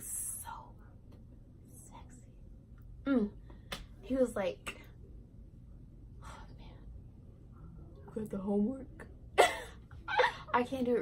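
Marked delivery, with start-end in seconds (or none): 0.0-2.2 breathy hushed gush; whisper. Retold or imitated. Girl gushing to viewers about her crush, whispering. 6.2-9.1 whispered. Retold or imitated. Girl imitating her crush whispering in her ear, soft mock murmur. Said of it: "So he whispered in my ear".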